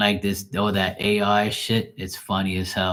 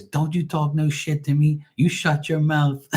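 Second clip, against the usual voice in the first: mountain lion voice; 7 semitones higher than usual. Retold or imitated. The mountain lion sassing the commentator in a high voice. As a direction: talk back like an offended lion. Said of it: "He goes".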